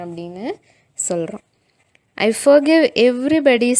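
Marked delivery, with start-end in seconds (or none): 2.2-3.7 loud, higher melodramatic groan; boy pretending to die, forgiving everyone loudly; hammed-up dying. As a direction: play the martyr on his deathbed. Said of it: "முணங்கிட்டு கத்திக்கிட்டு act பண்ணிட்டு".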